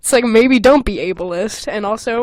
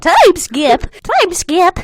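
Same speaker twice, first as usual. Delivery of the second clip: mock announcement; 7 semitones higher, 5 dB louder, much slower than usual. Shown as a demonstration. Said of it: "That's how I wrote it".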